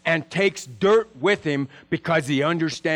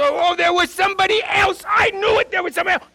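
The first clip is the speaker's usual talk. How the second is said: higher, louder mock gasp of discovery; mock surprise; 12 semitones higher, 4 dB louder, faster than usual. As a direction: act out a gotcha realization, pitched way up. Church's acted surprise.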